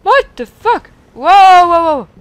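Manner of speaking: loud, high shocked outburst; startled shout. Real, not acted. Gamer shocked by an attack, loud high outburst. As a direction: blurt it out in real shock.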